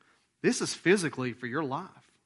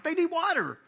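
exclaimed; 13 semitones higher than usual. Real, not acted. Preacher exclaims.

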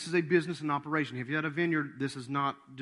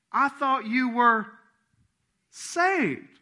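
loud, high, drawn-out accusation; accusing jab; 9 semitones higher, 7 dB louder, much slower than usual. Acted. Buddies catching the convert slipping, loudly accusing. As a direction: stretch it out and punch the last word.

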